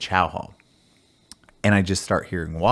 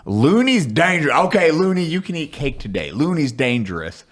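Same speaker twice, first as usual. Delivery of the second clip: loud, higher mock drill sergeant bark; drill sergeant impression; 7 semitones higher, 3 dB louder than usual. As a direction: ham up a drill instructor praising a private. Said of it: "drill sergeant was like".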